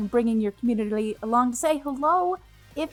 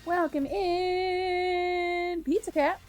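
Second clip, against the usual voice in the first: drawn-out welcome; 8 semitones higher, 4 dB quieter, much slower than usual. Real, not acted.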